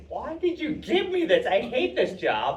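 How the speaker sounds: high-pitched mock whine, then dragged out; hammed-up exasperated griping; retold whiny protest. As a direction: imitate yourself complaining about a chore. Acts out complaint about landscaping job.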